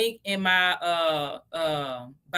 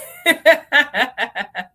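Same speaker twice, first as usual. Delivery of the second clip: real laugh; 4 semitones higher, 8 dB louder than usual. Real, not acted. Laughing at own diet story.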